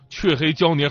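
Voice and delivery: ordinary talk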